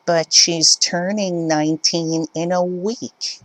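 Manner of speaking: high, pleading mother's voice; entitled mother. Acted. Mother arguing daughter's age, high indignant voice. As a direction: whine the excuse upward.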